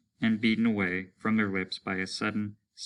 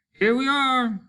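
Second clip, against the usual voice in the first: much higher, loud call; 6 dB louder than usual. Acted. Soldier calls to the group on reaching shore, loud high call. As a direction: shout it to the men.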